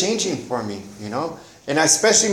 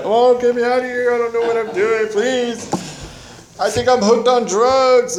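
much higher, pleading, helpless begging; desperate plea; 9 semitones higher than usual. Retold or imitated. Son calling his mom for rescue, desperate pleading. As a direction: beg to be rescued, voice up high and thin. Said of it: "and I was like".

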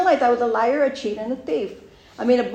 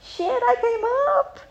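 high delighted squeal; giddy at a lucky find; finding a free microwave, high and gleeful; gleeful first thought. Retold or imitated. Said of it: "first thought".

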